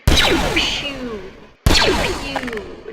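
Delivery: put-on kid-style blaster noises; laser pew sounds. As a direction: spit out toy laser sounds. Imitating gun sounds.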